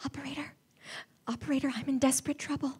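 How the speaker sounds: fast, breathless whispered plea; woman hiding, whispering to operator; frightened whisper. Acted. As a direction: whisper urgently so no one hears.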